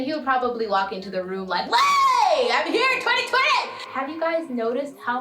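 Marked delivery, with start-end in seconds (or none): 1.7-3.7 loud, high-pitched bursting-in entrance; the year as a person. Acted. Twenty twenty walking into the room, loud and high-pitched. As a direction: announce yourself with a big high shout. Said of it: "if twenty twenty was a person".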